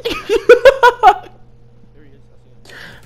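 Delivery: high, loud burst of laughing; uproarious laughter. Real, not acted. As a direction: explode laughing at your own punchline. Cracking up at own punchline.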